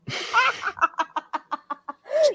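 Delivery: high-pitched rapid laugh; a run of high giggles. Real, not acted. Co-host caught out the fake goal news, laughing. Laughs after catching the tease.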